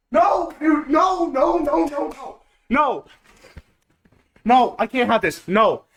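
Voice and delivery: high, cracking shouts then fading; real despair; anguished denial. Real, not acted. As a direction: refuse to accept it.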